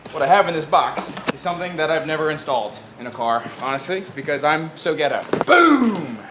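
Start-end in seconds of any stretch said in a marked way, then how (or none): 5.5-6.2 high, punchy reveal; excited reveal. Real, not acted. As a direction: announce it like a prize. Unboxing reveal.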